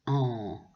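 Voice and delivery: en as a pure nasal vowel, falling; silent N. Shown as a demonstration. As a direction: say en low with no N at the end. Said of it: "on ne prononce pas le N". (« en » nasal, le N muet)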